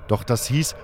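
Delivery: usual speaking voice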